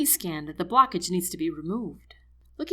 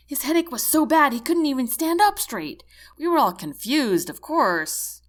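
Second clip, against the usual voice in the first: loud, higher, excitable babble; babbling; 6 semitones higher, 8 dB louder than usual. A narrator's reading. Seaman goes on about the headache to the medic, babbling. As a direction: rattle on without waiting for a reply. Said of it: "this babbling is going to drag on".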